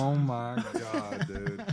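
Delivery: laughing through the words; laughing while talking. Real, not acted. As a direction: giggle your way through it. Remembering the funny episode.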